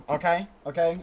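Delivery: ordinary talk, nothing marked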